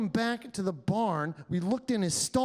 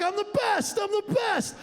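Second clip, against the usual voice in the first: bragging uncle; 11 semitones higher than usual. Retold or imitated. Uncle bragging about softball, in a high cocky shout. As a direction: puff up like a boastful old man. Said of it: "He's like".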